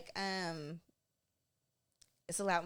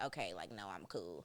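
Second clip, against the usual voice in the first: low-pitched, softer mock-cool brother; imitating brother; 11 semitones lower, 6 dB quieter than usual. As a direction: play a brother casually opting out, low. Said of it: "but then he was like".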